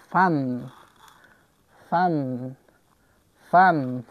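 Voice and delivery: "فن" with an open front a; A as short "ا". Shown as a demonstration. Teacher giving an example of the ا sound, "فن" with front a. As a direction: model "فن" as a separate word with a front vowel.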